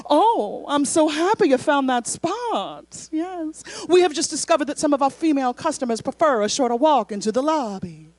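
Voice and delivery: imitated Southern sweetness with stretched words; joyful Southern woman. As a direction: play a delighted Southern hostess, smiling. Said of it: "genuine Southern smile. Her eyes just lit up with joy".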